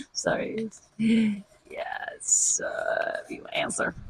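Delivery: whisper. Real, not acted. Reading quietly aside, then whisper.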